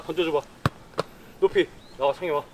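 ordinary talk, nothing marked

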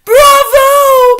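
very high, loud shriek of delight; witches cheering. A narrator's reading. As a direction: cheer like a frenzied crowd. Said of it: "cried the witches".

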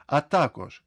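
ordinary delivery

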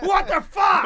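very high, loud outburst; mock furious shout. Man in car park fight, imitated furious shout. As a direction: imitate an outraged yell. Retold shouting match over littering.

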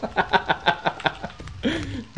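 high wheezy laugh; high laughter. Real, not acted. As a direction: break into high helpless laughter.

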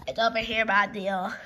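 deep, even-pitched character reply; low character voice showing off the prize; low put-on voice. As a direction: drop far down in pitch for the answer.

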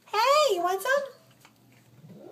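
very high, drawn-out coo. Real, not acted. Offering a kitten some food, high slow coo. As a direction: stretch it out high and inviting.

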